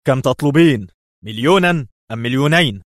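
loud, high, impatient demand; sharp. A narrator's reading. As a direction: press hard for a figure. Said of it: "قال في حدة".

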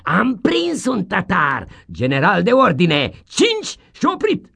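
high, loud, triumphant teacher crowing; loud gloating schoolmaster; gleeful schoolmaster shout. Acted. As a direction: crow it out like a pleased tyrant. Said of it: "și striga mulțumit".

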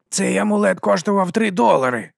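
high-pitched, plaintive whine; whining. A narrator's reading. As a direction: whine about it like someone hard done by. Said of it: "занив брат-клозетник".

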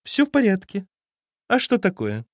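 high, sweetly false mermaid voice. A narrator's reading. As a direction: lure him with a false sing-song. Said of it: "фальшивым русалочьим голосом". (фальшивым русалочьим голосом)

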